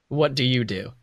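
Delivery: ordinary delivery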